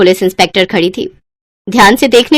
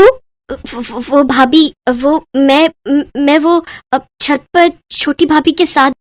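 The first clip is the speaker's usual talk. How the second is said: frightened stammer; 5 semitones higher, slower than usual. Acted. Frightened Ritika stammers to Babita.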